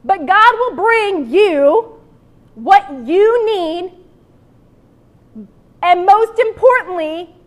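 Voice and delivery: very slow, spaced-out repeat of the line. Shown as a demonstration. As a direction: say it again slowly so they can catch it. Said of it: "We'll do a little repeat".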